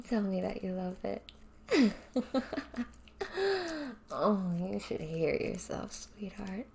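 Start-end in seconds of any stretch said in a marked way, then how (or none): 1.3-4.1 a short laugh pitched up; teasing laugh. Acted. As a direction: laugh at how she sounds. Teasing partner.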